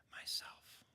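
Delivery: very soft whisper. A narrator's reading. The lion answers who he is, in a soft whisper. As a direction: whisper it so it is almost inaudible. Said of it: "in a whispered voice, so softly".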